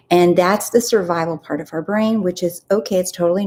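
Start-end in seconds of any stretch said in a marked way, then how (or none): none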